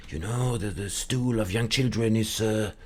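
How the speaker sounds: put-on French accent. Grandpa justifying his liquor to an interviewer, French accent. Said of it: "I don't know why it's a French accent".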